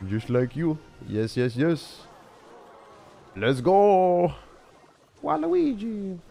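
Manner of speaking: high-pitched cartoon Italian accent. Streamer clowns at his opponent in a high Italian accent. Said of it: "I'm allowed to do an Italian accent".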